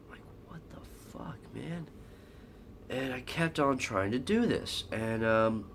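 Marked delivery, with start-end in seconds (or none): 0.1-1.9 exasperated whisper, much softer; exasperierter Flüsterton. Retold or imitated. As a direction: whisper it in disbelief at yet another lost sale. Exasperated at failed sales calls.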